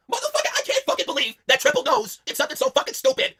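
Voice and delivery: furious high rant. Acted. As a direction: spit out outrage high.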